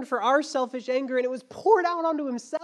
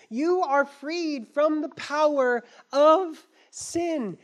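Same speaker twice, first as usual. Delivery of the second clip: normal talking voice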